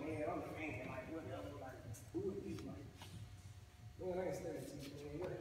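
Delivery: a lightly voiced cocky youngster, soft and dismissive; braggadocious kid. Mimicking a boastful teen shrugging off adults, lightly voiced. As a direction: brush everyone off like a bored know-it-all. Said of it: "very boastful, and you braggadocious".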